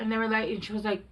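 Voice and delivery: ordinary talk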